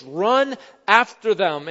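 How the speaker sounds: loud, drawn-out order; commanding. Acted. Pharaoh tells his officials to give chase, commanding. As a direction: send the men off with a forceful, slow command.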